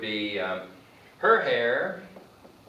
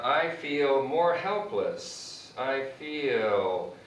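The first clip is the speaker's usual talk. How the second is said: very slow, MORE stressed; slow model sentence; 3 semitones higher, much slower than usual. Shown as a demonstration. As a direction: spell it out slowly for learners. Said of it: "Another example would be".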